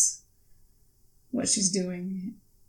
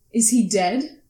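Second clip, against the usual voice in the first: higher, louder, pressing questions; scowling demand; 4 semitones higher, 6 dB louder than usual. Acted. Daughter presses Claire about her missing father, upset and demanding. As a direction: demand answers, getting upset. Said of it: "she scowls a bit".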